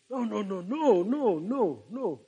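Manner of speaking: singsong string of no's, rising and falling; wavering, softly crooned objection; drawn-out mock refusal. Joking preacher refuses to be called foolish boy, singsong refusal.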